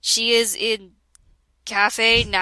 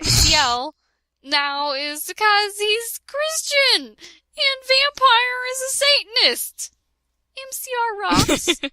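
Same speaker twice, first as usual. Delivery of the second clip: squeaky, mock-sincere author's note, much higher; 11 semitones higher than usual. Reading a teen author's note aloud, high and mocking. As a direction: lampoon a teen writer's note, pitched way up.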